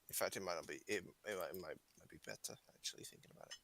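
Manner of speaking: drops from quiet to whisper; fading to whisper. Real, not acted. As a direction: trail off into a whisper. Talking himself down.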